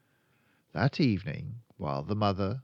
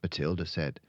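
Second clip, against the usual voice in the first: usual speaking voice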